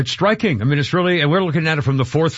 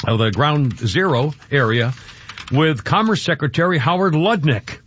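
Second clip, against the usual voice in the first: ordinary talk, nothing marked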